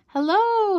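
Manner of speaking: high, drawn-out hello; long, high storybook call. A narrator's reading. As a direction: call across an empty hillside. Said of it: "he cried".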